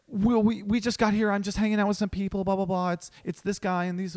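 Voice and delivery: imitating a friend in a high voice; mock flustered, high-pitched patter; flustered excuse-maker.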